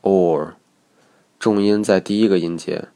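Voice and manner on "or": "or" drawn out on its own; 音标读音. Shown as a demonstration. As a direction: sound out "or" as one vowel sound. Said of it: "A U R的读音是". (单独示范 "or" 的读音)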